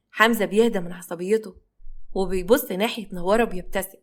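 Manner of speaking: ordinary delivery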